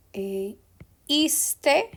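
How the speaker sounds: ordinary talk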